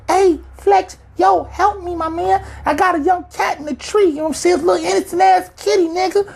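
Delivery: put-on very high squeaky kid voice pleading; hammed-up little kid begging a hero. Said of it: "Shorty like".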